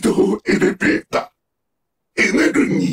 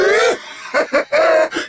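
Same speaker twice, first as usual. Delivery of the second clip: high villainous laugh; mocking evil laugh; 6 semitones higher than usual. Villain mocking a struggling foe, high scornful laugh.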